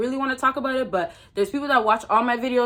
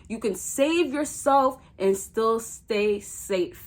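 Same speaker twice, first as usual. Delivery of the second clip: slow, deliberate delivery; slow and emphatic; said slower; much slower than usual. Shown as a demonstration. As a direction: spell it out slowly so it sinks in. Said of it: "Let me say that again, slower".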